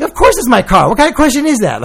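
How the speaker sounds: outraged that he'd even ask; indignant. Retold or imitated. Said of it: "like, indignant".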